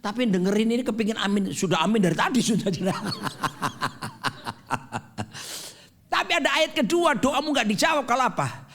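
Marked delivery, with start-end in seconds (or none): 2.7-5.7 laughs. Real, not acted. Laughing at his own joke.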